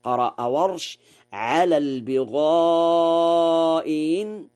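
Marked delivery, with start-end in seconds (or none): 1.3-4.5 long held البغاء, then an eased hamza on إن; softened second hamza on إن. Shown as a demonstration. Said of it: "بتسهيل الهمزة الثانية".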